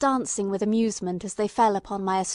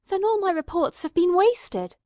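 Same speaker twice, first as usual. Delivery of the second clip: trembling voice; 9 semitones higher, 5 dB louder than usual. Acted. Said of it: "My voice trembled".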